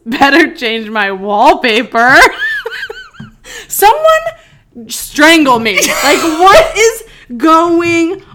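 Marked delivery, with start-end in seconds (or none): 2.2-3.6 very high shrieking laugh; embarrassed shriek of laughter; squealing laugh. Real, not acted. Laughing at old wallpaper. 3.7-8.2 high, loud, very slow wailing; hammed-up crying whine; mock anguish. Real, not acted. Mortified by own old texts.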